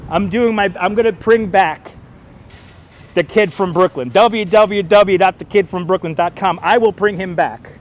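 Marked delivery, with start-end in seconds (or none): none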